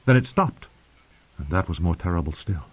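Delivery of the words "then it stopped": ordinary delivery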